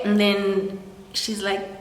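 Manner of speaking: ordinary talk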